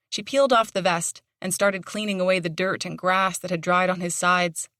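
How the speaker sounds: ordinary delivery, nothing marked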